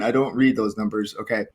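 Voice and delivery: ordinary talk, nothing marked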